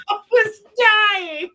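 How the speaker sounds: words squeezed out through laughter; laughing while talking. Real, not acted. Friend retelling the clip to Chris, laughing through words. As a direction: talk through a fit of laughter.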